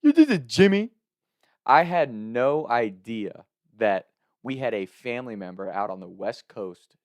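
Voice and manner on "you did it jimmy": high, loud cartoon character voice; put-on high cartoon boy voice; Jimmy from South Park. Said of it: "Jimmy character from South Park voice".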